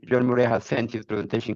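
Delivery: ordinary delivery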